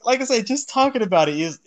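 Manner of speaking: high, loud outburst broken by laughter; overcome, laughing exclamation. Real, not acted. Fan recalling a heartbreaking episode to co-hosts, overcome and laughing. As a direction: cry out loudly through real laughter.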